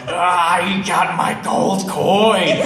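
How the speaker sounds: loud, drawn-out comic outburst; mock rage. Storyteller raging at beggar over his coin, hammed-up anger. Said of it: "this rage is building up inside".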